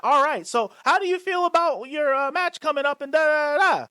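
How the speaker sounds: breezy reporter pitch, tossed off; mock interviewer question. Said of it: "and it's like".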